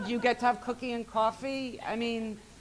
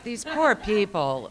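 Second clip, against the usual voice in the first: pitying; slower than usual. Retold or imitated. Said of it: "I just thought".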